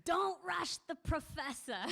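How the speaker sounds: amused old woman playing the lofty professor; haughty old lady. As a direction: put on airs, slow and high. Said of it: "she goes".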